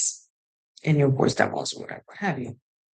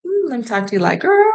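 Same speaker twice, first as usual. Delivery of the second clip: put-on high, sassy girlfriend talk, climbing higher on girl; bestie voice; 8 semitones higher than usual. Shown as a demonstration. To her bestie, slipping into a high sassy girl voice. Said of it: "when I'm dipping in and out of".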